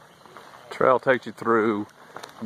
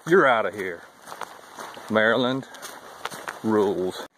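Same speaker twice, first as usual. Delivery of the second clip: ordinary delivery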